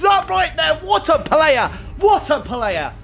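loud, high goal celebration shout; a real delighted roar; excited shout. Real, not acted. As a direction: yell it like your striker just scored.

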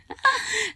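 quick surprised laugh; short laugh. Real, not acted. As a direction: burst out laughing briefly. Surprised by going inside tree.

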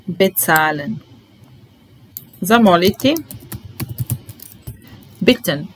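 ordinary talk

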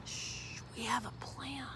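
unvoiced hushed whisper. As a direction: share the secret breathily. Whispered refrain.

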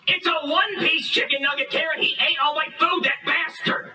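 high-pitched furious complaint; outraged. Acted. Man tells Karen his food was eaten, outraged. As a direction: rant in aggrieved disbelief.

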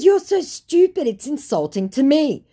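high, loud, exasperated insult; affronted. Retold or imitated. Woman insulting the scammer, affronted. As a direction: act personally offended by stupidity.

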